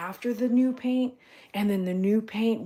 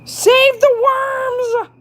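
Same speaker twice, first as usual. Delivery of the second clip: very high, loud and slow; drawn-out call; 14 dB louder, much slower than usual. Real, not acted. Owner calling out to rescue worms, high slow loud cry. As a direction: stretch every word as a loud cry.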